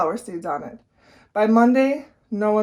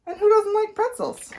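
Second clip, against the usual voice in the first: talking himself into it; 12 semitones higher than usual. A narrator's reading.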